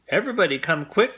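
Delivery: urgent, eager summons; excited call. A narrator's reading. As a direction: hurry everyone over. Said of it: "called Arthur".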